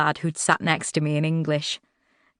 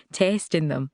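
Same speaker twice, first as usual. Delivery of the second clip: ordinary delivery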